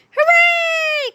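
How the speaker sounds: put-on high, squealing kid cheer; children cheering. A narrator's reading. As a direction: cheer like a classroom of happy kids. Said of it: "cried the children".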